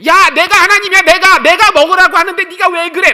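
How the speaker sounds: loud, high exasperated yelling; hammed-up furious divine outburst; irritated God impersonation. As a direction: scold as an annoyed God at full volume. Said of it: "짜증난 목소리로".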